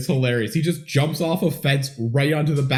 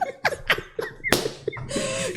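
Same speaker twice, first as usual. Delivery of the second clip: high breathy laughter burst; real laughter; 7 dB quieter than usual. Real, not acted. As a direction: dissolve into laughing. Laughing at podcast title.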